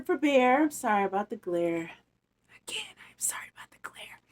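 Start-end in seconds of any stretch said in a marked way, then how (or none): 2.7-4.2 breathy whisper, quick; whispered apology. Real, not acted. Reader whispering an apology to her audience. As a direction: whisper it again, sheepishly.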